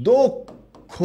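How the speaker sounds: দক্ষ said as "dok-kho", both vowels a closed o; correct pronunciation demo. Shown as a demonstration. Teacher showing students how the word is pronounced, দক্ষ as "dok-kho". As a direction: sound out দক্ষ in two slow, high, separated syllables, "dok... kho". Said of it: "এটার উচ্চারণটা হবে এইরকম".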